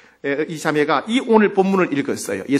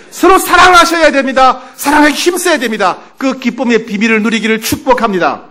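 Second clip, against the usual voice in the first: loud, raised exhortation; full-force preaching; 6 semitones higher, 9 dB louder than usual. Real, not acted. Pastor blesses the congregation as God's people, exhorting forcefully.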